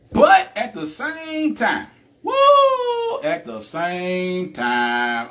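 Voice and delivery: loud rising woo breaking into a cry; emotional outcry. Real, not acted. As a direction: let the feeling burst out mid-sentence. Emotional cry, sister reacts.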